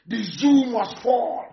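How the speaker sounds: slow, emphatic declaration; slow slogan. Real, not acted. Agitator vowing to topple the state, slow and emphatic. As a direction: spell out the slogan slowly and firmly. Chanted slogan, first take.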